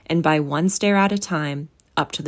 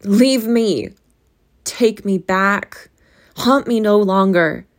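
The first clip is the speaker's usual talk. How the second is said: loud, slow anguished cries; desperate shouts; 4 semitones higher, 7 dB louder, slower than usual. A narrator's reading. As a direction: shout as you struggle. Scrooge wrestles with the ghost.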